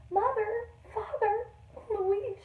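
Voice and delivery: high calling names through tears; lost girl on a rock, high tearful calling; crying out. Acted. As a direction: call for family, lost and scared. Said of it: "she cried".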